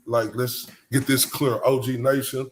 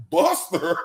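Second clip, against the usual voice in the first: mock quote; 12 semitones higher than usual. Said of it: "Kayla was like".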